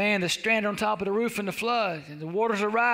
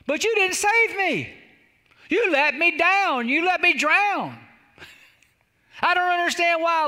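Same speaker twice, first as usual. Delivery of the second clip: acted wounded wail in words; aggrieved complaint; 6 semitones higher, 4 dB louder than usual. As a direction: plead your grievance to God. Drowned man complains to God.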